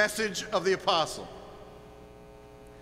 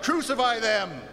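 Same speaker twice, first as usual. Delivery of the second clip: moderately raised accusing cry; 2 semitones higher than usual. Acted. Preacher voicing a church in controversy, crying out. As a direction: cry out like an embroiled congregation. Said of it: "you cry out".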